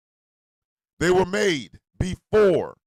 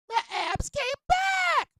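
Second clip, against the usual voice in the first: much higher, slow squeaky voice; mock proud squeal; falsetto squeak; 3 dB quieter, slower than usual. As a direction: echo it in a silly high falsetto. Falsetto joke echo.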